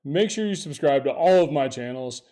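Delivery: loud, punchy tech promo read; announcer. Acted. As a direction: hype it like an ad. Loud video intro pitch.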